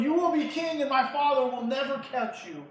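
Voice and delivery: high-pitched rousing assurance; emphatic encouragement. Acted. Jonathan strengthening his discouraged friend David, rousing encouragement. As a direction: lift his courage with a ringing promise. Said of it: "he encourages him".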